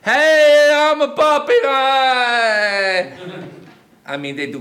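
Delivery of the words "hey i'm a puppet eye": high, loud, slow puppet voice; loud silly puppet showing off. Acted. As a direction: ham up a goofy puppet. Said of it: "doing some cool stuff like this".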